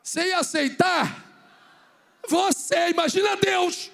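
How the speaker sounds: loud, high rising challenge to the crowd; preaching shout. Real, not acted. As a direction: shout it at the congregation. Pregação em voz forte. (grito de pregador, agudo e forte)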